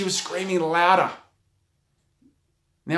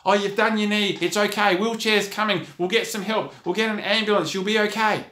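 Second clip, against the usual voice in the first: higher-pitched, urgent imitated panic; panicked voice; 6 semitones higher than usual. Onlookers crowding round an injured netball player, imitated panic. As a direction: reassure in a flustered, raised voice. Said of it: "they said it with a panicked voice".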